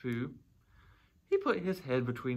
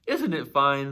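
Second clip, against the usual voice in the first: loud call down to a friend; delighted shout; 9 dB louder, slower than usual. A narrator's reading. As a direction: call it out proudly and loudly. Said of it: "shouted Winnie the Pooh".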